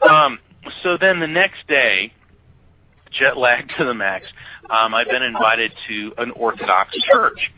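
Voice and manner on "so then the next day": ordinary talk, nothing marked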